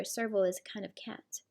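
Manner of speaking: soft whispered aside. Real, not acted. Explaining to the child in a whisper.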